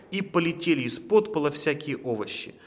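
ordinary delivery, nothing marked

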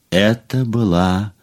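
usual speaking voice

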